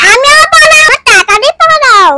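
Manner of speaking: high, loud play alarm; pretend panic. Child flees the mischievous monkey, mock-panicked shout. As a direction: shout it as if running away.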